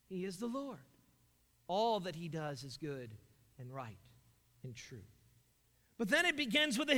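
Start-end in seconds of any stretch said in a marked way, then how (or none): 0.1-5.0 hushed reverence. Real, not acted. Hushed close after climax.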